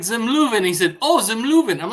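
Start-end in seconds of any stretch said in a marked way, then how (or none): 0.0-0.6 higher, insistent restaurant name; exasperated repetition. Acted. Tenant to landlord playing dumb, exasperated insistence. 1.0-1.8 feigned realisation. Acted. Landlord to exasperated tenant, mock sudden understanding.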